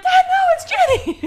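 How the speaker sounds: very high, loud protest, laughing; mock frantic yell. Kids telling dad he has wrong person, mock frantic. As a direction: shriek the correction, playing up the panic.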